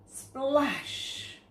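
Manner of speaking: splash sound effect. Acted.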